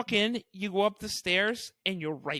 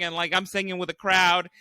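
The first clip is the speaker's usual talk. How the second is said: ordinary delivery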